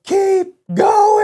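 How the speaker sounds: very high, loud holler; re-enacted echoing-distance shout; long-distance yell. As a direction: urge them on from far across a clear cut. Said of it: "I yell".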